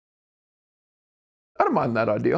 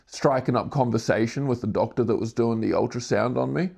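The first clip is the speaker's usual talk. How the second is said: ordinary talk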